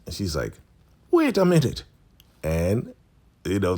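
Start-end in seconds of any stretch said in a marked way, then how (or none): 1.1-1.8 high-pitched mock disbelief; voicing Elder Mother Marva. Elder looks at the pregnancy test, high mock surprise. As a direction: exclaim it way up high, as a startled elder. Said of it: "Elder Mother Marva's like".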